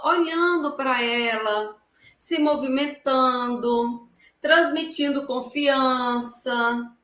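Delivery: ordinary talk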